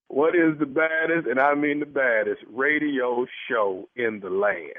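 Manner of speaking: slow, low, emphatic host delivery; deliberate showman's build-up to the punch; radio hype slogan. Acted. Host cues the show slogan.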